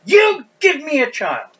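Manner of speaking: high, loud, forceful demand; acted angry demand. Rachel demanding a child of Jacob, loud and accusing. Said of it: "She demands of him".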